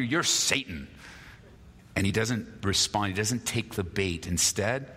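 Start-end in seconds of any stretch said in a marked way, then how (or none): none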